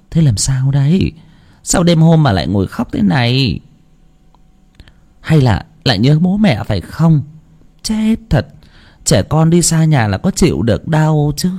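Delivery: put-on a drowsy granny worried about the boy; sleepy grandma. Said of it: "ngáp ngắn ngáp dài bước ra".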